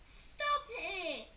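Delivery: high, loud whine; whiny stop it. A narrator's reading. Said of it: "said Peter".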